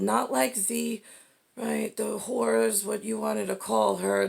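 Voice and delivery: ordinary delivery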